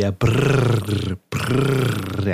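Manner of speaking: lip trill. Shown as a demonstration. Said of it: "Eu não sei fazer o brrr".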